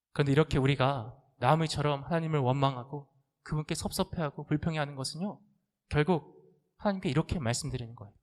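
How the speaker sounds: normal talking voice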